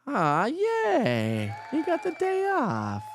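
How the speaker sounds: very high, drawn-out squeal of delight; playful cheer. Real, not acted. Host to viewer with the day off, playful cheering. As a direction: stretch it into a high, silly cheer.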